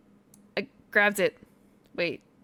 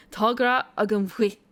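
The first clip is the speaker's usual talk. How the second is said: corrected "Tá gra agam cuit" in a self-conscious Irish attempt; bad Irish accent; 4 dB louder than usual. Shown as a demonstration. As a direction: blend the Irish phrase with cuit fixed, still a bit off. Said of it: "My accent's really bad".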